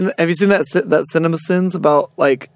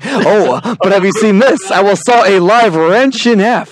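louder, higher hammed-up stage magician; magician's patter; 4 semitones higher, 5 dB louder than usual. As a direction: proclaim the next trick with showy force. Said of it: "He's like".

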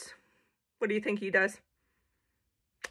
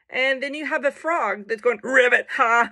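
ordinary delivery

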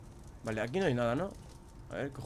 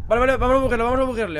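high, loud eager burst; urgent excitement; 10 semitones higher, 6 dB louder, faster than usual. Real, not acted. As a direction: call out the plan in a sudden rush. Rushing to glitch the game enemy. (agudo y fuerte, con urgencia)

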